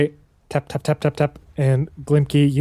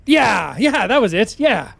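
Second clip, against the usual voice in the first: high, stammering nervous dwarf; higher and tripping over his words, bluffing; flustered stowaway; 8 semitones higher, 4 dB louder than usual. Acted. Stowaway faking a shared past with Faunnelin, high flustered stammer.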